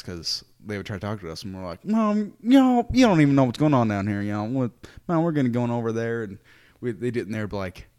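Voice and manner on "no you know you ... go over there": put-on much louder, drawled Southern accent. Missionaries answering annoyed colleagues in a thick Southern accent. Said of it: "talk in a Southern accent".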